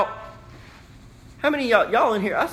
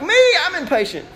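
eager congregant; 10 semitones higher, 3 dB louder, faster than usual. Retold or imitated. Impatient congregant answering.

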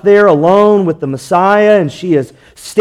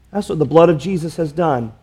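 ordinary talk

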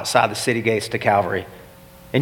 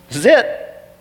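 rising panic; 9 semitones higher than usual. Acted. Preacher voicing Barabbas as panic takes hold. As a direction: let the dread break into alarm. Barabbas realizing his fate.

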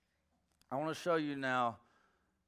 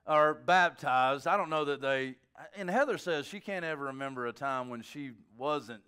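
ordinary delivery

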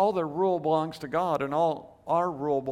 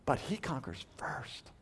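hushed, breathless whisper; softly whispered emphasis; 8 dB quieter than usual. Real, not acted. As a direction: lean in and whisper it. Emphatic secret-like point.

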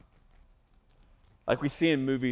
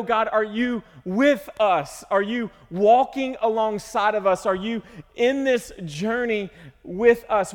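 pleading prayer; 6 semitones higher, 5 dB louder, slower than usual. Acted. Preacher voices pleading prayer.

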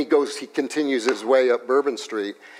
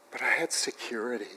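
ordinary delivery

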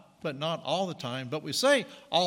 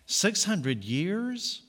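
slow, drawn-out higher amazement; amazed; 6 semitones higher, 3 dB louder, much slower than usual. Acted. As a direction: stretch it out in wonder. Marveling at tree's age.

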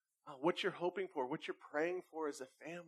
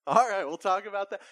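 much louder, upbeat dad reply; hearty dad; 5 semitones higher, 14 dB louder than usual. Retold or imitated. Pastor voicing himself answering his son, loud. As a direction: play the patient dad, cheerful and loud.

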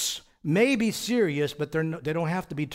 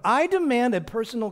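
demanding; 7 semitones higher, 4 dB louder than usual. Acted. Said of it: "He rebukes him for demanding what?".

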